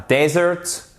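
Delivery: desert with the first syllable stressed, short e. Shown as a demonstration. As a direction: hit the DE of desert, open E. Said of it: "avec l'accentuation sur la première syllabe".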